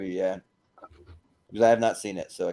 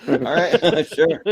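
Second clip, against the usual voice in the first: higher and laughing, giving in; 6 semitones higher, 4 dB louder, much slower than usual. Real, not acted. Host laughing at a friend's video-game logic. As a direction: laugh as you concede.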